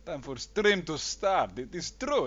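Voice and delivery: usual speaking voice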